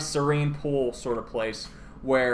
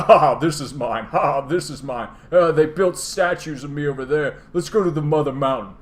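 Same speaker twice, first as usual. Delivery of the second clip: louder, smug showing-off; boastful dragon; 3 semitones higher, 5 dB louder than usual. Retold or imitated. Nicol Bolas showing off his realm, smug boasting. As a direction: brag like a vain tour guide.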